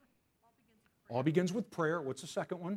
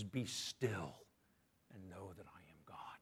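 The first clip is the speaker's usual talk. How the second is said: hushed quote; 11 semitones lower, 11 dB quieter than usual. Shown as a demonstration. Quoting Psalm about stillness.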